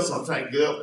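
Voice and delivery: ordinary talk, nothing marked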